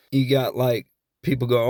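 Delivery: usual speaking voice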